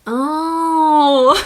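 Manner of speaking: loud, high, long-drawn oh; hammed-up dawning recognition; exaggerated realization. Officers finally recognizing the smiling traveler, exaggerated realization. Acts out officers seeing her smile.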